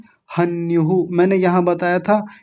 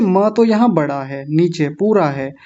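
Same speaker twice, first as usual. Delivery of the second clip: ordinary talk, nothing marked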